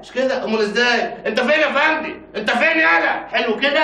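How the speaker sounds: high, angry calling out for the boss; angry shouting. Acted. Father rages at the staff, shouting for the boss. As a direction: storm in and yell for him.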